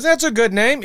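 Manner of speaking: high-pitched put-on celebrity voice; Ratzenberger. Actor introducing himself at the office bar, high impression. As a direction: imitate the visiting actor, way up high. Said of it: "you sound just like him".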